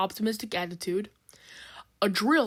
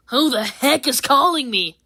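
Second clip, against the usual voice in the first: grumpy stranger; 5 semitones higher, 9 dB louder than usual. Acted. Annoyed stranger on phone.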